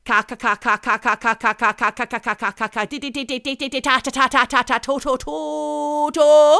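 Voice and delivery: rapid, repeated syllables, rising to a loud held end; imitating taped stuttering syllables. Showing how the sufferer recorded herself, imitated repetitive syllables. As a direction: mimic stuck speech, building to a long high note. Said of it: "on tape going".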